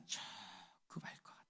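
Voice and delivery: hushed whisper, almost to himself. Acted. Pastor confiding to the congregation, in a whisper. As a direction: breathe it out like a confession.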